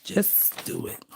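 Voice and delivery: breathy whispered aside. Real, not acted. Telling herself about the page, whispering. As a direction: whisper it to yourself.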